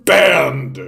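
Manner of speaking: a loud, hammed-up "Banned!"; mock ban shout. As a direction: slam it down like a censor's stamp. Mock censor bans a comment.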